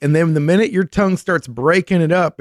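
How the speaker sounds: ordinary talk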